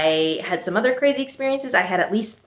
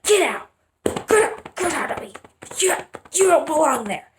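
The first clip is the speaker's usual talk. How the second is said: much higher, strained mock order with a whine; childish angry commands; 9 semitones higher than usual. Girl pinching her belly at the baby, whiny high scolding. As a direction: scold the imagined baby, pitched up. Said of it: "pinch, be like".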